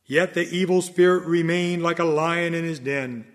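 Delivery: usual speaking voice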